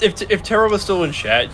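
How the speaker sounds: ordinary delivery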